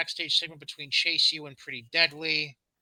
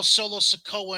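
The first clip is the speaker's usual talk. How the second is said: usual speaking voice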